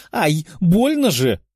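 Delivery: high, drawn-out yelp of pain; pained cry. A narrator's reading. Princess jabbed in the side by a tail, pained cry. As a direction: cry out in protest at a sudden jab. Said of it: "выкрикнула принцесса".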